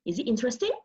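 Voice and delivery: normal talking voice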